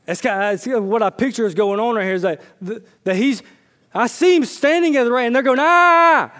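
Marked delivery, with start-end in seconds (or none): 5.6-6.3 a long, very high, very loud howl; howling mob yell. Retold or imitated. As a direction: howl like an angry crowd drowning out a voice. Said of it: "they just start howling at him".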